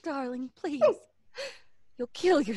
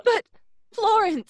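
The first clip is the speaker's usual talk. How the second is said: louder and higher outcry; urgent cry; 9 semitones higher, 8 dB louder than usual. Acted. Kay crying out as Florence holds her back. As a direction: call her name in desperate protest.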